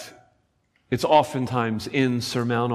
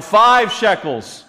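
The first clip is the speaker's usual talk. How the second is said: loud, high auction call; auctioneer; 6 semitones higher, 5 dB louder than usual. Retold or imitated. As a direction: cry out the bids like an auctioneer. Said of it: "the auctioneer crying out".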